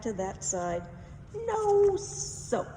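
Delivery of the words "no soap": high, loud cackling witch retort. Acted. Witch mocking DeWitt's failed swats, high and loud. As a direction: squeal it like a smug witch.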